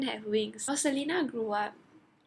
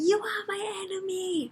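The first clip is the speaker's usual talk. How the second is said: imitated scream; 9 semitones higher, 3 dB louder than usual. Imitating Selena screaming at someone, mock scream. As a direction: cry it out high, without full volume. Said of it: "Selena screaming".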